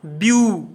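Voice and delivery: ब्यू held long as a separate syllable; first syllable ब्यू, drawn out on its own; syllable-by-syllable pronunciation. Shown as a demonstration. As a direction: sound out ब्यू alone, stretching the oo. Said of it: "देखिए ब्यूटीफुल का उच्चारण करते हैं".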